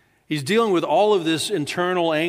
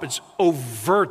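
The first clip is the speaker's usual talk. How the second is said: ordinary delivery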